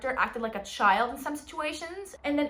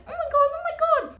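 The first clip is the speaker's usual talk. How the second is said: oh my god shrieked much higher and louder; high exasperated squeal; 5 dB louder, faster than usual. Real, not acted. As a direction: squeal it, totally fed up. Exasperated about the tiny heroine.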